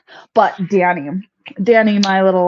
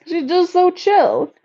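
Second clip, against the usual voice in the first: cooing; 9 semitones higher, slower than usual. Real, not acted. Cooing over calm bunny.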